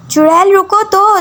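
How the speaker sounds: mock name called pleading; calling out. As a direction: shout after her down the corridor. Danial chases Fariyal out of class.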